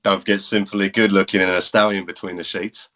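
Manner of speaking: put-on cocky rock star in a British accent. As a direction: tease her in a swaggering British accent. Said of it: "That was a fun accent for it".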